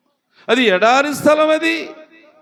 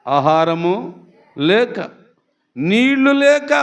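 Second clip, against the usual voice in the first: ordinary talk, nothing marked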